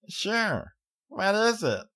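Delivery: put-on high, eager little-girl voice; curious small child; eager young filly. A narrator's reading. Child character voice.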